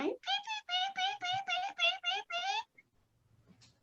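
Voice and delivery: very high, soft peeping chicks; tiny chicks cheeping; chick peeps. Acted. Storyteller voicing the little chicks, very high peeps.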